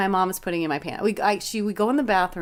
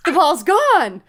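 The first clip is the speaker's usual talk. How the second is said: higher, louder showman announcement through a laugh; mock magician reveal; 7 semitones higher, 7 dB louder, much slower than usual. Shown as a demonstration. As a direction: announce it like a kid magician's big finish. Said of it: "you practiced and practiced and said".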